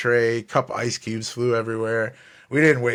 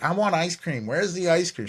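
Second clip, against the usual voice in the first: put-on whiny spoiled kid; 6 semitones higher than usual. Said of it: "she's like".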